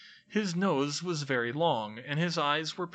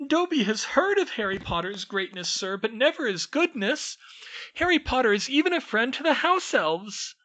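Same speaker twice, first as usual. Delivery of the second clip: very high squeaky elf voice, wondering; a tiny servant astonished; amazed house elf; 11 semitones higher, 4 dB louder than usual. Acted. House elf learns of Hogwarts elves, squeaky and amazed. Dobby's voice held.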